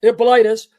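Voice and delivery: Hippolytus as hip-uh-LIE-tus; alternative pronunciation. Shown as a demonstration. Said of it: "some pronounce it".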